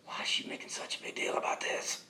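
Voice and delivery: hushed, whispery mock complaint; inward grumble. Husband complaining about wife in his mind, whispered grievance. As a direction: grumble it under your breath.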